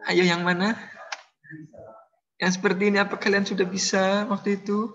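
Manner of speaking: put-on teacher clowns in a celebrity accent to wake up his class; mock celebrity accent. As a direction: play a TV star's Indonesian accent for laughs. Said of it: "Ini logat Laut Cinta Luna kayaknya".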